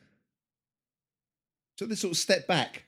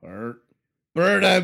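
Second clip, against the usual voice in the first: angry mob leader; 7 dB louder, slower than usual. Pretend. Mob chanting at witch burning.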